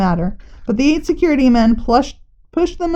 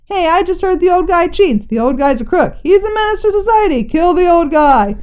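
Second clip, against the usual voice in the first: rising mob cry; 7 semitones higher than usual. Acted. Crowd turning on old man.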